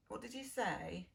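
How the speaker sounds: plain reading voice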